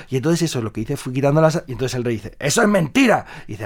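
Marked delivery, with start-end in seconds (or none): 2.4-3.3 higher, indignant accusation; king calls the story a lie; king accusing. Acted. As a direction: snap it out as the king.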